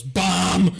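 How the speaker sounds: sudden booming one-word shout; super loud. Retold or imitated. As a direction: blurt it out like a walkie-talkie blast. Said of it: "Like, super loud".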